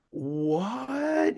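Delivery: high, incredulous "What?"; stunned what. Retold or imitated. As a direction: disbelief rising sharply in pitch. Hearing the unexpected book deal.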